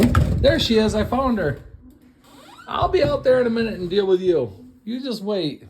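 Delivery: loud, high mock threat; calling out. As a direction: call across to someone with playful menace.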